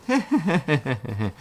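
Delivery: light giggling laugh; giggle. A narrator's reading. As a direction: giggle with delight. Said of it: "He began to giggle".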